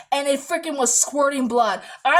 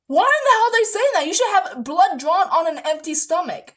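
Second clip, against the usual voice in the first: fast, much higher and louder outraged protest; indignant mom; 4 semitones higher, 4 dB louder than usual. Retold or imitated. Mom objecting to the nurse, a fast, loud indignant rant. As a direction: let the mother fire off her outrage. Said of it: "Mom's like".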